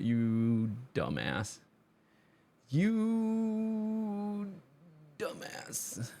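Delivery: long drawn-out YOU, soft; mock self-scolding. Player watching his own blunder, drawn-out mock scolding. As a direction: stretch it out, rising high then dropping to a hush. Scolding himself after the misplay.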